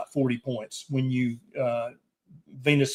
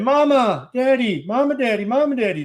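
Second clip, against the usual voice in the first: put-on high sing-song child calls; toddler calling; 10 semitones higher, 4 dB louder than usual. Father imitating his toddler calling from her crib, high sing-song. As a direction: call out like a little girl waking up. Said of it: "she'll start out saying".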